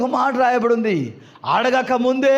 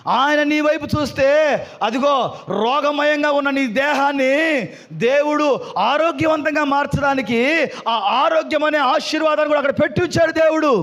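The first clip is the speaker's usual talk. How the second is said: raised declaring voice, phrase ends held; fervent preaching; 6 semitones higher than usual. Real, not acted. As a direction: proclaim the blessing over the congregation. (ఆశీర్వాదాన్ని గట్టిగా ప్రకటిస్తూ)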